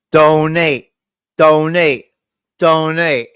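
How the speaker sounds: loud, monotone chant; evenly spaced, robotic plea; mimicked alert voice. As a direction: repeat it like an automated announcement. Mimics repeating donation alert.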